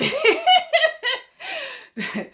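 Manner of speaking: very high, loud cackling laugh; high peals of laughter tailing off; big laugh. Real, not acted. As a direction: burst into long high-pitched laughter. Laughs at her nail trouble.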